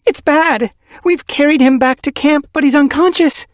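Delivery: high, loud, breathless panic; terrified she-cat reporting an injured kit; desperate report. Acted. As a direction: blurt the news in a frantic rush. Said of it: "her eyes wild".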